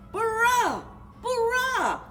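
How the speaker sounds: ordinary delivery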